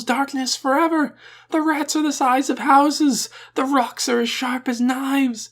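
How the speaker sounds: loud, very high crazed wailing. A narrator's reading. Man back from the unknown regions warning a crowd, high wailing. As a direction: howl a stream of doom at full pitch. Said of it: "In a high, rapid voice, he wailed out".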